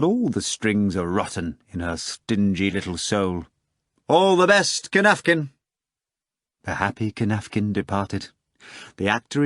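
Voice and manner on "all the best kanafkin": high, bright theatrical farewell; a stage actor's cheery send-off. Acted. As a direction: sing it out like a stage showman.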